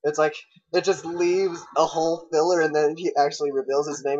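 ordinary talk, nothing marked

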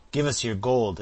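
loud, high, furious bark at the captive; furious demand. A narrator's reading. Robber threatening the peddler for his gold, furious shout. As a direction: snarl it out, weapon in hand. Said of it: "exclaimed the other furiously".

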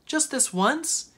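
put-on high, drawn-out child's plea; narrated child pleading with parents; begging. A narrator's reading. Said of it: "Hannah begged".

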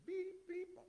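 high mock beep beep of a truck; truck reversing beeps. Preacher imitates the thief's truck backing in, high beeping. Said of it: "bring the truck".

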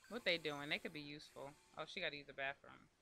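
normal talking voice

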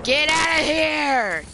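gruff character shout. Acted. Character voice in game.

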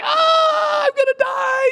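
hammed-up child crying out over a stubbed toe; wailing child. Pastor mimicking a crying grandchild for the church, high mock wail. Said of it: "They're like".

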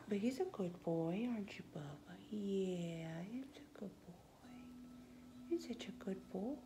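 soft whispered praise to the bird; hushed doting whisper. Real, not acted.

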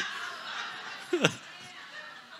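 laughing. Real, not acted. Laughing at own story.

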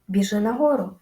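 ordinary delivery

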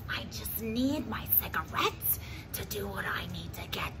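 hushed whisper of a craving. Acted. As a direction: whisper it like a secret. Smoker confessing her need.

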